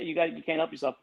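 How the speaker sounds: ordinary talk, nothing marked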